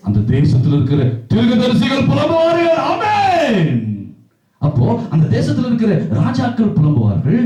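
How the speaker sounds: high, ringing proclamation in rising phrases; full-force preaching. Acted. Preacher proclaiming who in the land will lament, impassioned. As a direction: preach it out like a roll call of mourners.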